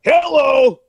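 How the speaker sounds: high, loud irritated hello; pissed hello. Retold or imitated. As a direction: answer the phone annoyed. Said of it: "and I'm pissed".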